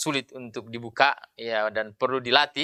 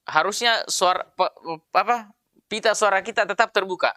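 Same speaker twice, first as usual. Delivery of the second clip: ordinary talk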